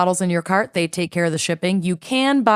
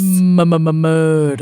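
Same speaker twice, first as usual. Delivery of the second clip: stuttering dramatic echo; 5 dB louder than usual. Pretend.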